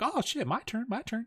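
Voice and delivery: high, quick-fire voice of a begging flock; high, soft, eager pigeon chatter; clamouring pigeons. Acted. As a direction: squeak it softly, like pigeons crowding in. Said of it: "flock on your ass and be like".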